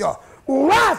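loud high yelp as the plane drops; hammed-up scream of turbulence; mock shout of alarm.

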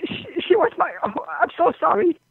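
real tearful stammer, pitched high; high, halting voice through tears; crying. Real, not acted. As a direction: break down and stumble over the words. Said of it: "I'm crying".

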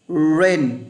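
"Rain" with a curled untrilled r; English Rain. Shown as a demonstration. Teacher modeling "Rain" in English. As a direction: curl the tongue, no trill on "Rain". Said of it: "phát âm chữ mưa nhé".

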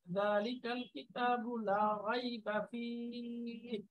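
slow, deliberate recitation; slow careful Quran reading. Shown as a demonstration. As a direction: recite each sound with care, slowly. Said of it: "এভাবে খেয়াল করে করে".

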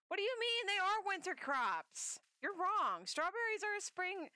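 indignant mock villager. Mocking the villager's claim.

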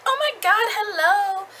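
high, loud squeal of delight; gushing excited greeting. Retold or imitated. D greets Katie at the store, squealing with delight. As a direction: gush over meeting her at last.

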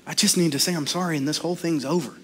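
low, soft, quick inner voice; hushed self-coaching, hurried and low; private thought. Acted. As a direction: think it quietly to yourself. Private thought lying in bed.

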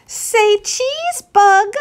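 high, sing-song photographer's call; playful girl voice. Acted. Ruthie playfully posing the clouds for a photo. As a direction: coax the clouds to smile.

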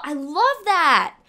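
loud, high, drawn-out gush; excited gushing. Real, not acted. Streamer reacting to a feature, excited gushing. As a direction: burst out slowly and loudly with delight. Delighted by feature being read.

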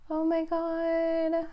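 slow, high, drawn-out disbelief; breathless amazed gasp of words; stunned oh my God. Real, not acted. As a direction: let the surprise stretch the words out slowly. Dolphins reappeared, caught off guard.

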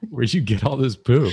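mock scolding. Scolding a horse drawing.

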